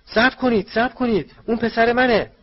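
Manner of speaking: very high, loud cry; desperate shout. A narrator's reading. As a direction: yell to stop an attack. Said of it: "صدایی از پشت سر فریاد زد". (فریاد بلند و ملتمسانه)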